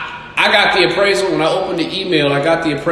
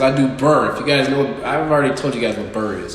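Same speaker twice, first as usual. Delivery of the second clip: normal talking voice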